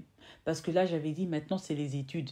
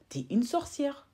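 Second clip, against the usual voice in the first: 1 retold accusation; 10 semitones higher, slower than usual. Calling the girl a witch.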